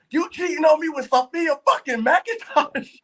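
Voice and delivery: high-pitched, slow, imitating an insecure partner going off; hammed-up jealous outburst, pitch way up and drawn out; mock jealous accusation. Mimicking an insecure partner accusing them of cheating, mock outrage. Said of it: "now they going off on you".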